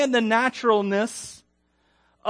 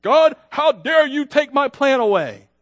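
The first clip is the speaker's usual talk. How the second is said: indignant cry at God, louder and higher, slowed down; ticked off at God; 6 semitones higher, 7 dB louder than usual. Retold or imitated. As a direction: Jonah protesting God, outraged. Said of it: "he is ticked at God".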